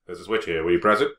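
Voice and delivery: ordinary talk, nothing marked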